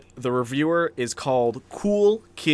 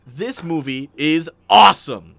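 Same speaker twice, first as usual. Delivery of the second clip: loud, drawn-out proclamation; emphatic mock rave; 8 dB louder, slower than usual. Podcast host reading a movie review to co-host, loudly gushing. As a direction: belt out the rave with big stresses. Reads an enthusiastic one-star review.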